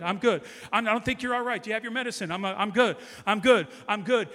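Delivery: loud, driving preacher's declaration; full-force preaching. Acted. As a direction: preach it out loud to the whole church.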